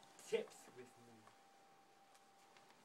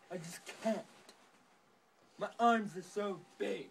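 ordinary talk, nothing marked

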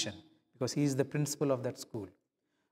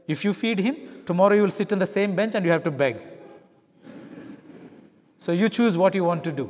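inner voice arguing; 4 semitones higher, 7 dB louder than usual. Retold or imitated. Inner voice warning, argued aloud.

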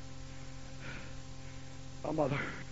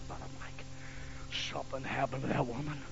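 ordinary delivery

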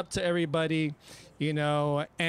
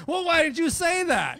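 exasperated; 10 semitones higher than usual. Retold or imitated. Exasperated reply.

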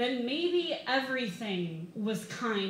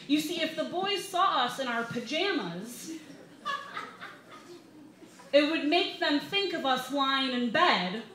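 mock explanation; 5 semitones higher, 7 dB louder than usual. Mocking the camp rule.

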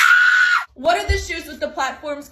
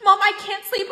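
ordinary talk

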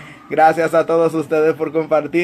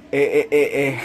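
normal talking voice

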